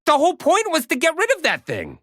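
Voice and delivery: exasperated outburst. Acted. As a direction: blurt it out in disbelief. Warder argues with Oma.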